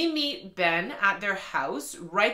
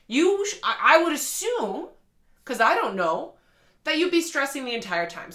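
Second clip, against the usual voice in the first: exasperated rant; 7 semitones higher than usual. Real, not acted. Reviewer scolding the character Ben, exasperated and incredulous. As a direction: argue with the book like it can hear you.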